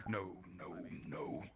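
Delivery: whispered no. Acted. Character whispers his refusal under his breath. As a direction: breathe the words with no voice.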